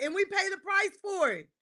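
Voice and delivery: ordinary talk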